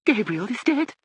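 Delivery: put-on very high, rising old-lady voice; astonished question. A narrator's reading. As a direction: deaf lady taking in the news. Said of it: "she said quite loudly".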